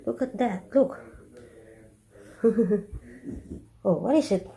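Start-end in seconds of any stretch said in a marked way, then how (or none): 2.4-3.0 quiet fond chuckle; soft laugh. Real, not acted. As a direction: chuckle softly. Laughing at the dog.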